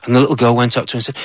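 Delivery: plain reading voice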